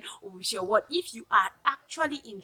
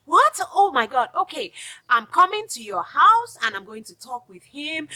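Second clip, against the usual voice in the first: excited friend; 6 semitones higher, 7 dB louder than usual. Retold or imitated. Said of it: "So the friend is like".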